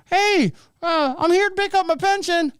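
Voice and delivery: very high puppet voice; Jeff Dunham-style dummy. Acted. Dead man puppeted at post office, high dummy voice. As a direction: speak up high like a ventriloquist's dummy. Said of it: "Jeff Dunham on him".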